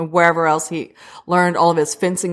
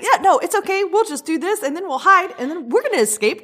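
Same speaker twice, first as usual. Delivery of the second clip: bubbly upbeat; 10 semitones higher than usual. Retold or imitated. Upbeat Jibril voiced.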